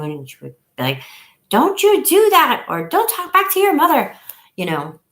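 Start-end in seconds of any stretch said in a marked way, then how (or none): 1.5-2.7 much higher, louder imitation of a tiny Italian grandma scolding; grandma yelling. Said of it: "and she would yell at you". 2.9-4.1 grandma yelling. Retold or imitated. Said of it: "and she would yell at you".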